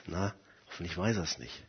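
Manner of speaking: very soft and low, under the breath; hushed private thought. Acted. A sinner hopes to himself before God, hushed. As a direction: think it aloud, barely voiced.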